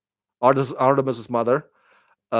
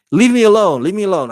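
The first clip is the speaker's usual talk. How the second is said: raised, lightly exasperated brush-off; mock irritated rebuff; 6 semitones higher, 4 dB louder, faster than usual. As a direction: act out pushing someone away, first push louder. Victor brushing off a friendly advance.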